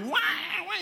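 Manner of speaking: berrido de recién nacido, imitado; llanto de bebé imitado. Retold or imitated. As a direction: imita al nene llorando en brazos. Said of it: "está llorando". (llanto chillón de bebé, fingido)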